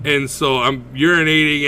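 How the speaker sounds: ordinary talk, nothing marked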